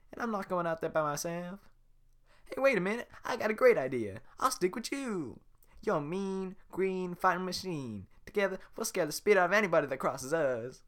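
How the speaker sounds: fast-talking admirer; Donkey fanboying over Shrek; gushing Donkey. Acted.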